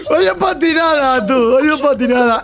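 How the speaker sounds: laughing. Real, not acted. Laughing at a fail.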